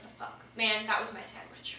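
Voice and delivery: very soft, low grumble; muttered dismay. Acted. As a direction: grumble under your breath, put out. Acted dismay at losing the sandwich.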